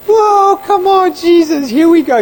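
high, loud burst of triumph; elated cheer. Retold or imitated. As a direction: cheer as a lost chance comes back. Elated that interview is on.

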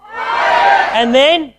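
loud, high call for the crowd to answer; shouted prompt. Real, not acted. Preacher calling the crowd to shout back, loud. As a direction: pump up the congregation. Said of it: "say like you mean it".

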